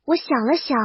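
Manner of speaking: ordinary delivery, nothing marked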